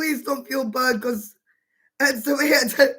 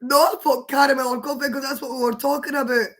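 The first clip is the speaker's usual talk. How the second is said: usual speaking voice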